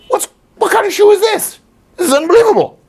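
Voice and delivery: a dog scandalised by a slapping shoe; indignant dog. Retold or imitated. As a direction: play the dog, shocked and offended. Dog reacting to the slap.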